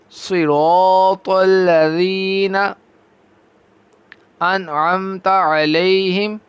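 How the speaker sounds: slow, drawn-out melodic tajweed chant; Quran recitation. Shown as a demonstration. Teacher reciting Quran for students. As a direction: recite each verse long and measured.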